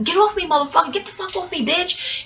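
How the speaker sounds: put-on girl struggling free of the man's grip; retold yell. Fighting off the man, retold.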